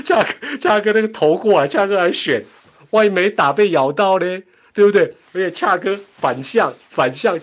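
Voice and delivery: high-pitched, loud, laughing protest; excited giggly rant. Real, not acted. Streamer teasing fans about their pick, high and giggly. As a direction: squeal it out through laughter.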